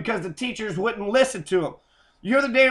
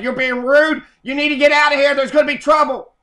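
retold principal yelling, louder and higher; mock angry yell; 4 semitones higher, 6 dB louder than usual. Retold principal ordering him out, in a loud mock yell. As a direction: bark it back the way the principal threw you out. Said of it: "And he's like".

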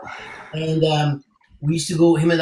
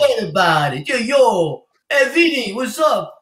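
higher, louder mock New York Italian accent; 6 semitones higher, 3 dB louder than usual. Singer playing at the host's New York Italian accent. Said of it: "I'm gonna try to get your accent".

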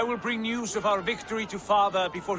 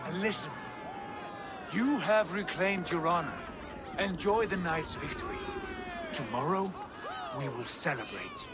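softer, settling voice; calm and quiet; 6 dB quieter than usual. Acted. Brother quietly calming Eivor after the fight.

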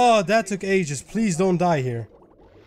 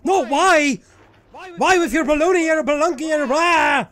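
loud, high-pitched nonsense raging; frustrated rant; 9 semitones higher, 5 dB louder than usual. Real, not acted. Gamer raging at another failed level, frustrated rant. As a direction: yell gibberish complaints at the game.

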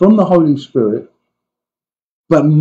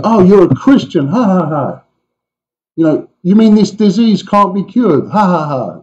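mocking scoffers; 5 semitones higher than usual. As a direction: scoff with a hammed-up laugh. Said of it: "people gonna laugh at you".

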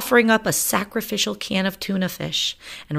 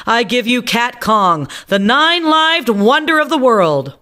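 grand showman; 8 semitones higher, 8 dB louder than usual. Acted. Grand unveiling to crowd.

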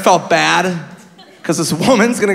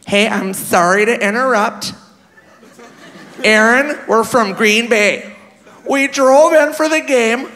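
put-on drawn-out, higher Green Bay accent; 5 semitones higher, slower than usual. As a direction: imitate a sweet older Wisconsin fan, slowly. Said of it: "You know that stupid fuck accent?".

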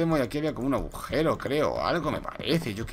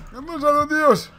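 sharp and much higher; alarmed; 10 semitones higher than usual. Real, not acted. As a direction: blurt it out startled. Sudden alarm.

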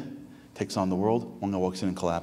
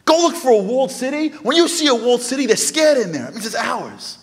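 high, insistent sermon delivery with rising challenges; impassioned preaching; 10 dB louder than usual. Real, not acted. Rabbi challenging the congregation's belief they are just regular, impassioned preaching. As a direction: preach it hard, hammering each rhetorical question.

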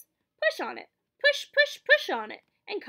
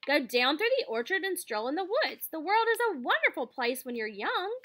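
higher, louder goose reeling off a list; excited goose; 5 semitones higher, 5 dB louder than usual. A narrator's reading. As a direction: bubble over with ideas. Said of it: "said the goose".

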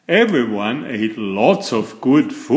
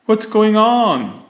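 higher, louder puzzled question in Moose's voice; 9 semitones higher, 4 dB louder than usual. A narrator's reading. Moose arrives and asks what is going on, puzzled and raised. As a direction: voice the confused Moose. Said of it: "asked Moose".

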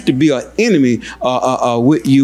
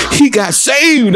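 high, drawn-out cry of triumph; exultant preaching; 10 semitones higher, slower than usual. Real, not acted. Preacher announcing a man saved in the store, exultant. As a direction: stretch it out high as the punchline. Climax of the imagined salvation story.

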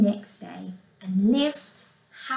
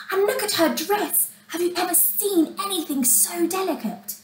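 high, softer gush of admiration; admiring wonder; 6 semitones higher, 4 dB quieter than usual. A narrator's reading. Ladies at the ball admire Cinderella's dress, admiring. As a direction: marvel at it, high and light.

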